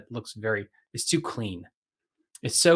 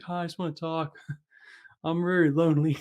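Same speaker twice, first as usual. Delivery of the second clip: lonely sad man; 5 semitones higher than usual. Retold or imitated. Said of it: "alone and sad".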